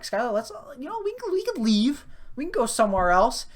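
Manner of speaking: high-pitched, halting mock plea; retold nervous urging. Talking roommate away from a pissed-off guy, retold nervous urging. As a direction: coax your friend out of trouble.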